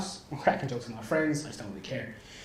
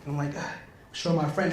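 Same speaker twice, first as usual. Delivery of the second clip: usual speaking voice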